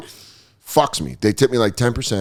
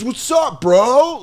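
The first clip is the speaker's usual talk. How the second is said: very high mock bro challenge; put-on high bro voice; imitated bro; 13 semitones higher, much slower than usual. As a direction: mimic a bro calling out, pitched way up. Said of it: "Like that".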